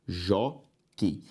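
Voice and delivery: "jockey" split as jó, quei; a pause between the two syllables of "jockey". Shown as a demonstration. Teacher separating the next word, syllable by syllable. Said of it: "vamos separar as próximas".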